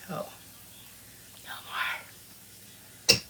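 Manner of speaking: a drawn-out playful whisper. Pretend. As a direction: breathe the words out without voice.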